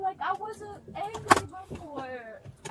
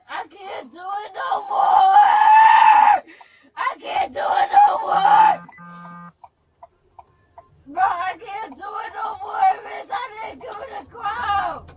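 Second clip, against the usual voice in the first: screamed, crying, much louder and higher; sobbing scream; 4 semitones higher, 11 dB louder than usual. Real, not acted. Woman being arrested screams at officers through sobs.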